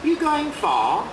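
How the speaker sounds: a hint of a kerb-crawler's come-on; lightly voiced stranger. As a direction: toss it out the car window suggestively. Kerb crawler picks up girl.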